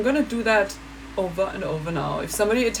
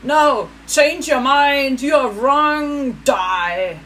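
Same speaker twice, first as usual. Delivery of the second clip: higher, louder ranting caricature; mocking angry German; 4 semitones higher, 5 dB louder than usual. Mocking Germans who shout her down, loud and ranting. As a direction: snap and rant like a furious zealot. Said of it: "one snaps and goes like".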